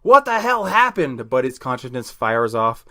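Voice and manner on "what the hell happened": high, startled exclamation; shocked outburst. Acted. Newly revived Buddy wakes confused, shocked. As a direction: cry it out in alarmed confusion.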